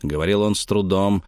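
usual speaking voice

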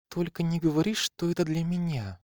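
put-on high, hopeful girlish voice; flustered hope. A narrator's reading. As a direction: fish for a compliment. Flattered girl gushing.

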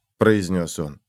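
plain reading voice

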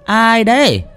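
high-pitched call into the dark. Acted. Old woman calling to someone crying in the garden, high calling voice. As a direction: call out to whoever is hiding there.

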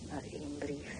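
soft, bitter whisper; hushed whisper. Acted. Bitter hushed remark about letters.

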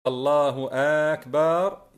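أكبر stretched into "aakbaar"; faulty takbir. Shown as a demonstration. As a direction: drag both vowels of أكبر after الله. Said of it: "من يخطئ مثل هذه الأخطاء". (تكبير خاطئ بمدّ أكبر: آكبار)

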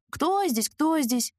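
high mock scared echo; mocking imitation. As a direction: parrot his fright back mockingly. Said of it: "Как кукушка-дрыстушка".

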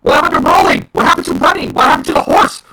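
incredulous angry questions; retold outraged yelling. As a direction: replay his shouted disbelief. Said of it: "I just stood up and said".

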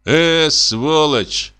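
slow, drawn-out disgusted grumble; grumbling. A narrator's reading. Hunter turns to the door, slow contemptuous grumble. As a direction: stretch the insult out with contempt. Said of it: "проворчал охотник".

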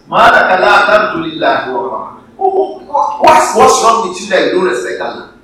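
high, loud preacher's rebuke; loud reproach. Acted. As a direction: demand an answer from the congregation. Preacher acts the reproach to people.

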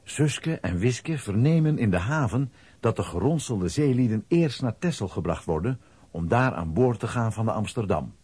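usual speaking voice

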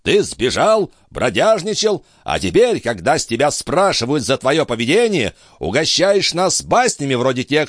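put-on high-pitched indignant old baron; irate high-voiced tirade; enraged roar. A narrator's reading. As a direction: scold furiously in a high voice. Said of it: "взревел он".

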